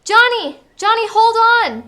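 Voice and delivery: high, loud call of alarm; urgent shout. Acted. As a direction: yell to him to hold on. Friend falling down the well.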